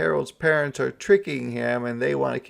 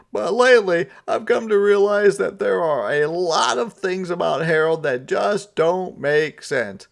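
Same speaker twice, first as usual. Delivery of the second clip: high, loud put-on Santa voice; a sighing, higher Santa; 8 semitones higher, 8 dB louder than usual. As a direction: play him sulky and fed up.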